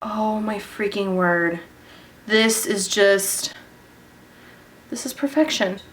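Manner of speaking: ordinary talk, nothing marked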